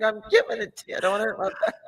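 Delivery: low mock voice. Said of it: "I'm like".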